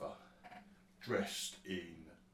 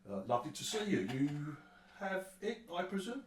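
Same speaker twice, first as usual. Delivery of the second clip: high-pitched, eager welcome; effusive wolf-man host; 8 semitones higher, 5 dB louder than usual. Acted. Wolf-man welcoming visitors come to see him, effusive high voice. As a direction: usher them in, pitch leaping up high.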